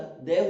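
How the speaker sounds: "दे" said clearly and slowly, with no "da". Shown as a demonstration. Teacher corrects students' "da" with a loud, slow "de". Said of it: "कई जगह दा कहते हैं".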